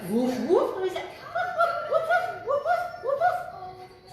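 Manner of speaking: quick, high-pitched yapping; puppy bark. Pretend. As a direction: yap like an excited little puppy. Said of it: "What noises do puppies make?".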